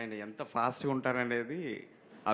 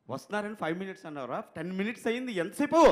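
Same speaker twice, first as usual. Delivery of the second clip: impatient director; 6 semitones higher than usual. Retold or imitated. Director scolds assistant over a ten-minute wait, impatient. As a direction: imitate a boss growing exasperated.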